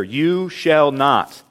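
"You shall not" said slowly with weight on each word; emphatic. Shown as a demonstration. Preacher shows where the Hebrew sentence's emphasis lies, emphatic. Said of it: "The emphasis of the sentence is right here".

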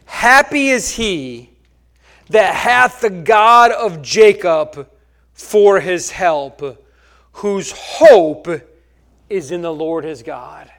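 slow, loud, solemn reading; scripture reading. Acted. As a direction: read the verse slowly, with weight. Reads scripture aloud.

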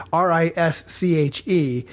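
normal talking voice

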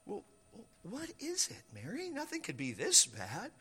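soft, concerned reassurance; hushed comforting, lifting on the question; gentle coaxing. Acted. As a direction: coax her quietly to open up. Joseph coaxing a weeping Mary.

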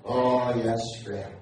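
usual speaking voice

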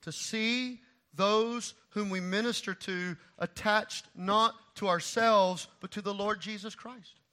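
slow and weighty, high; slow, deliberate. Real, not acted. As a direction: spell it out slowly. Slow emphatic statement.

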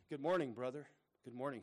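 usual speaking voice

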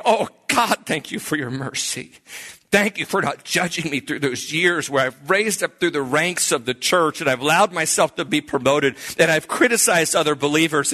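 passionate, high-pitched imitated prayer; retold crying out to God. New convert thanking God for mercy, crying out passionately. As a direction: pour it out in an acted fervent prayer. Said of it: "his personality is exploding and he's crying".

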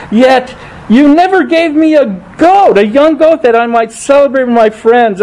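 high, aggrieved complaint to his father; high, bitter older brother voice; resentful older son. Acted. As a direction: sulk it out like the cheated elder brother.

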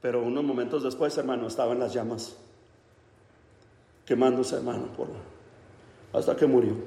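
low, soft, halting narration; somber, spaced-out phrases; hushed and grave. Acted. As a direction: drop right down for the tragic outcome. Grave aftermath of a fatal crash.

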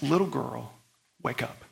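soft, low, tender; hushed fatherly coaxing; gentle, kind father. Acted. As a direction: wake a sleeping child softly. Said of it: "Like a gentle, kind father".

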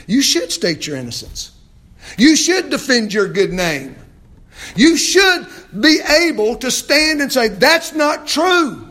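high, loud preacher's cry; impassioned preaching. Real, not acted. Preacher urging the maligned to defend their good name, impassioned.

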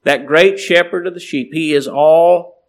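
ringing proclamation. Acted. Preacher proclaims God's power.

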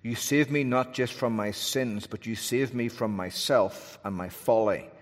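ordinary delivery

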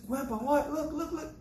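put-on very high, giddy small-boy voice; excited grandchild. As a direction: tumble over the words like an eager grandson. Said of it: "He's so excited".

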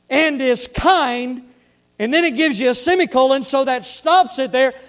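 loud, high-pitched pulpit preaching; full-force preaching. Real, not acted. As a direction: project hard to the whole congregation, stressing key words.

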